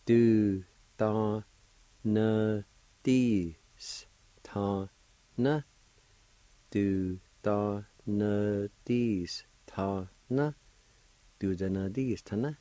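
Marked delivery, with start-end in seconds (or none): 0.1-5.6 Du da na dis ta na with pauses between syllables; teacher sounding out for students; separated syllables. Shown as a demonstration. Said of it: "is pronounced like this". 6.7-10.5 Du da na dis ta na drawn out very slowly; slow model for learners. Shown as a demonstration. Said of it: "is pronounced like this".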